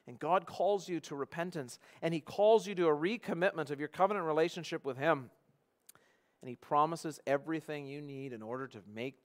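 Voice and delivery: normal talking voice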